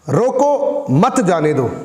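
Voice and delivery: slow, with a break after "रोको", then "मत जाने दो" together; pause after रोको. Shown as a demonstration. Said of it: "इसको मैं दो तरीके से बोलता हूँ".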